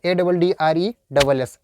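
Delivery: usual speaking voice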